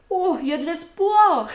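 loud, high burst breaking into a laugh; excited hope. Acted. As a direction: exclaim like a dog suddenly full of hope. Voicing hopeful dog getting eye contact. (espoir joyeux, fort et aigu, en riant)

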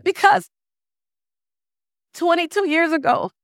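high-pitched, choked-up retelling; imitated tearful woman. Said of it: "she's crying the entire time".

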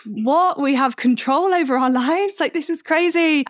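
like discovering a secret; amazed disbelief. Retold or imitated. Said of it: "I was like".

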